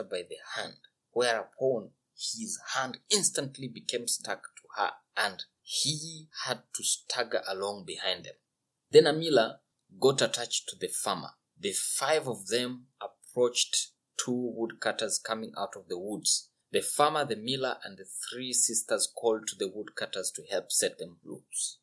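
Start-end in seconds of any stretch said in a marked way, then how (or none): none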